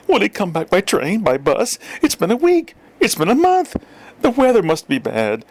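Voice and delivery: high fretting mother voice; anxious mother. A narrator's reading. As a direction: fret and worry, voice climbing high. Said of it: "His mama, on the contrary, couldn't wait".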